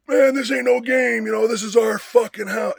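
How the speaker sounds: high, loud imitated yell; hardcore shouting. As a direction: shout it like a fired-up rioter. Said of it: "he's, like, really hardcore".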